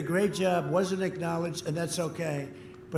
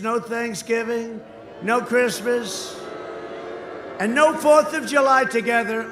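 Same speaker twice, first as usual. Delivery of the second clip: rally shout; 4 semitones higher, 6 dB louder than usual. Real, not acted. Rally attack on Biden.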